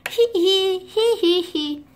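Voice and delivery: high spoken hee hees; mock villain giggle. Mega Bat Fox gloating, a high giggle.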